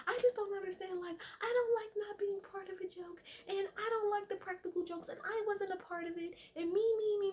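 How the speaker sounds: very high whiny complaint; mock someone making it all about themselves; mock freak-out. Sutton freaking out about the joke, high mocking whine. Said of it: "freaking the fuck out about this damn joke".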